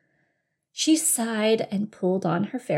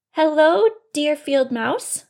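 high, drawn-out greeting; overly polite bunny; 7 semitones higher, 8 dB louder, slower than usual. Acted. Bunny trying to be nice to mouse, polite high voice. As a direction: greet sweetly, rising at the end.

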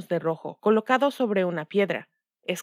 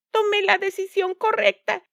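high gloating stepmother; wicked stepmother; 11 semitones higher than usual. A narrator's reading. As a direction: gloat over the win. Madrastra triunfante. (madrastra malvada triunfante)

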